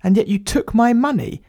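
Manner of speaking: high-pitched indignant questioning; indignant disbelief. Acted. Writer confronting plumber over failed repairs, indignant and high. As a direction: build in outraged pitch as the penny drops. Writer realises he was overcharged.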